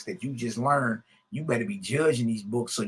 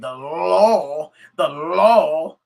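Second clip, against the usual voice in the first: a hint of a stern Judge Dredd, louder; 3 semitones higher, 9 dB louder, much slower than usual. Mocking law preachers by imitating Judge Dredd, stern. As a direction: bark it like a comic-book lawman. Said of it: "it sounded like Judge Dredd".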